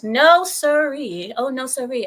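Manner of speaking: sassy slow refusal; mock refusal. Streamer turning down a bribe with a sassy drawn no sirree.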